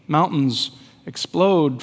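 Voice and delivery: ordinary delivery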